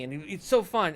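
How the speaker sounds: usual speaking voice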